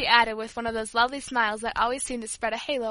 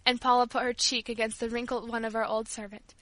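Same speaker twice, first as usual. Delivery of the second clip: usual speaking voice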